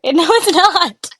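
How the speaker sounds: laughing denial. Real, not acted. Laughing disbelief.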